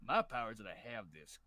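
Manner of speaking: low, slow cowboy drawl. Acted. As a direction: drawl like a gunslinger. McCree bit, slow low.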